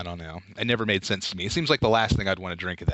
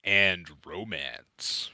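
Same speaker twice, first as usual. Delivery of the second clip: movie trailer voice; 4 semitones lower, much slower than usual. Acted. Slow trailer announcer.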